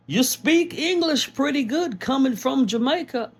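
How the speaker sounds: high, put-on patronizing voice; hammed-up surprised praise; mock condescending American. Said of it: "people asking me".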